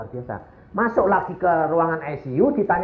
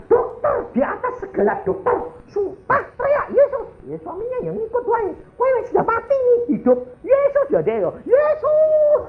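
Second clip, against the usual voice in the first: frantic shouting. Retold or imitated. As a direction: yell the name as if life depends on it. Wife reenacts her shouting.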